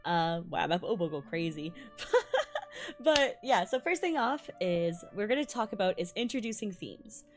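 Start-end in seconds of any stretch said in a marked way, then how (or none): none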